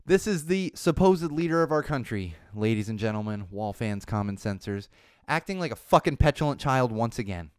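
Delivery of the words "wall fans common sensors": ordinary delivery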